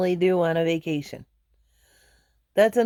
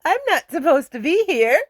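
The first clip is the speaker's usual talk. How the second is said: flustered; 8 semitones higher, 5 dB louder than usual. Retold or imitated. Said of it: "I said".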